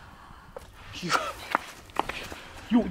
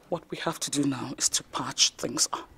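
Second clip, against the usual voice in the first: hushed; 9 semitones lower, 15 dB quieter, faster than usual. Acted. Mother plotting quietly with daughter.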